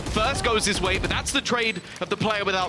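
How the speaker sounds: rising, excited play-by-play call; esports caster. Acted. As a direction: build the hype as the round swings. Live play-by-play casting.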